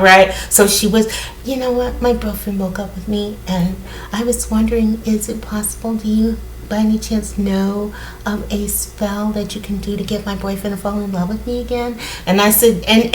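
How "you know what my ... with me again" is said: imitating a soft, sweet little voice, much softer; sweet and low. Client asking the witch for a love spell, hushed and sweet. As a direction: mimic a shy girl asking quietly, hushed and sweet. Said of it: "she said in that sweet voice, you know, and she said it low".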